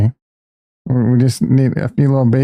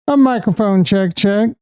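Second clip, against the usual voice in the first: pitched way up, sing-song; high sing-song mic check; 7 semitones higher than usual. Shown as a demonstration. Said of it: "repeated mic check takes".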